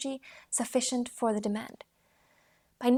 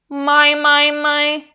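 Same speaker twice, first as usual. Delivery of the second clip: high, loud, resonant "my"; high ringing my; 4 semitones higher, 14 dB louder, much slower than usual. Shown as a demonstration. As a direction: ring "my" high and loud through the head. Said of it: "Soft palate open".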